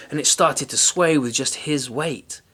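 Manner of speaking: usual speaking voice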